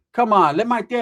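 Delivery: normal talking voice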